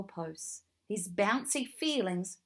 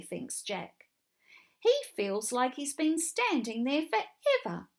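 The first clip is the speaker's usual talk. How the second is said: usual speaking voice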